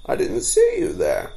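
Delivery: high, startled exclamation. A narrator's reading. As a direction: caught off guard, voice jumps up. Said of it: "said the startled storyteller".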